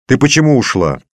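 high, loud reproachful question; scolding. A narrator's reading. Father asks daughter why she left, scolding.

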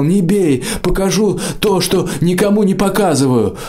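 high, terrified cry; frightened shriek. A narrator's reading. Избитый конюшник кричит Мраку: high terrified shriek. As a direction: shriek it out to stop the beating. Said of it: "Конюшник закричал".

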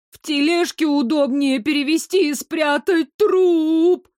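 high, tearful panic climbing to a wail; frightened wail. A narrator's reading. Girl fears her mother's cart, frightened. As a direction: wail it in growing fear. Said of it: "Ещё сильнее испугалась я".

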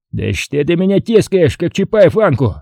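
high-pitched annoyed protest; kicking up a fuss. A narrator's reading. Colonel to friend tugging at him, balking indignantly. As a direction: protest at being held back. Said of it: "забрыкал подполковник".

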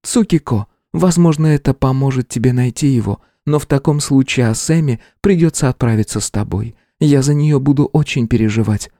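high, steady female voice; older woman voice. A narrator's reading. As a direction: speak as a seasoned woman warrior. Rangiku voiced, worried.

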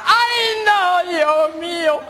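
high, hammed-up tearful wail; panicked whimpering. Schoolboy realizes he forgot his colour, panicked whimpering. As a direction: wail like a child in trouble.

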